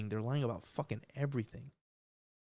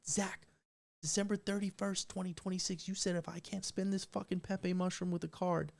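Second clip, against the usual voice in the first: lightly voiced listener, higher, reminding him of the bet; higher pitched mock challenge, calling him out; lightly voiced fan, higher, holding him to the thousand-dollar promise; mock audience member; 7 semitones higher than usual. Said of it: "if you tell me".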